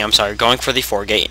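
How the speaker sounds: ordinary delivery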